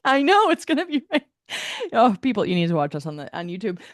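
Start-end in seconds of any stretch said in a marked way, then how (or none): 0.1-1.2 high, loud imitation of a sister; hammed-up prediction in a pitched-up voice; mock rain prophet. Teasing her sister's rain forecast, mock prophetic.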